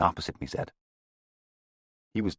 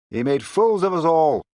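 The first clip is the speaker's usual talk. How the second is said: higher, louder and slow outburst; exclaiming; 12 semitones higher, 7 dB louder, slower than usual. A narrator's reading. Another inspector exclaims to the room, high and slow. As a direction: declare it bitterly. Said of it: "exclaimed yet another inspector".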